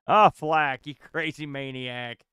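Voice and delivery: ordinary talk, nothing marked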